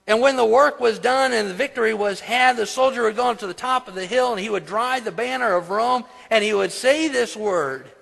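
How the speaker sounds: high, loud preacher's proclamation; preaching. Acted. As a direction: preach it out with rising force. Preaches at full force.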